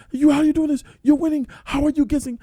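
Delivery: a hammed-up angry accusation. 218 accusing his partner of winning, angry and loud. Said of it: "He was getting mad".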